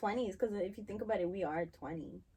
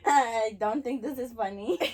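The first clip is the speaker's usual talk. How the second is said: laughing; 5 semitones higher, 10 dB louder, slower than usual. Real, not acted. Giggling through denial.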